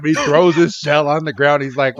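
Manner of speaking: ordinary talk, nothing marked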